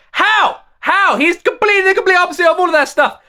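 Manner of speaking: high, loud disbelief; can't believe what critics claim; incredulous outburst. Real, not acted. Outraged rant at critics.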